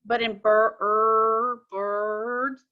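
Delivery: "bir-" broken off, then "er", then "birds" with only the R heard; sounding out. Shown as a demonstration. Teacher breaking up "birds" so the class hears the R. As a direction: split "birds" to pull out its R sound. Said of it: "I do hear just the R".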